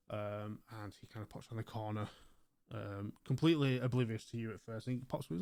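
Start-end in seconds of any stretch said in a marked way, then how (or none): none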